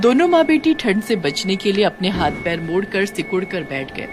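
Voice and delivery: ordinary delivery, nothing marked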